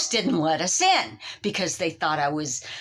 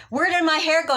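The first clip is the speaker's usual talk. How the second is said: high, louder panicked repeat; 8 semitones higher, 3 dB louder, faster than usual. Retold or imitated. Woman repeats her question to the stylist in panic. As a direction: shriek the question.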